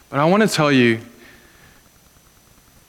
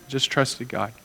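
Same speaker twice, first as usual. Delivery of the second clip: normal talking voice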